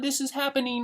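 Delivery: mock a person panicking over headlines; mock panicked voice. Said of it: "So you wake up saying".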